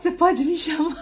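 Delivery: breaking into laughter mid-sentence; laughing. Real, not acted. Offering the neighbor cat-sitting, laughing through it.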